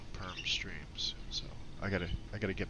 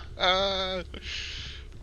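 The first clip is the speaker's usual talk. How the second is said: high, loud burst of laughter; real cackling laugh; hearty laugh; 11 semitones higher, 5 dB louder than usual. Real, not acted. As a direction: laugh out loud, drawn out at the end. Laughing during game stream.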